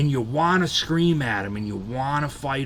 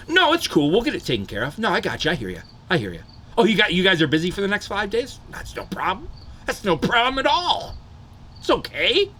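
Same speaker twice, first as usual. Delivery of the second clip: high-pitched, overly accommodating phone voice; be forcibly nice while holding back anger; put-on sweet, high customer voice; strained niceness; 7 semitones higher than usual. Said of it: "I'm talking real nice, okay? I'm like".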